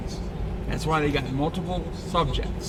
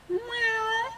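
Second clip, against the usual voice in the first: imitated meow. Meowing at a cat.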